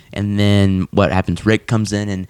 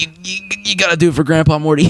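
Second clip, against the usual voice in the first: coaxing Rick; 7 semitones higher than usual. Retold or imitated.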